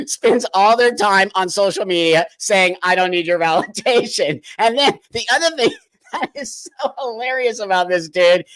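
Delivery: talking through laughter, can't help it; amused, laughing mid-sentence; real laughing. Real, not acted. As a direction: let helpless laughs break into the sentence. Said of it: "I'm laughing".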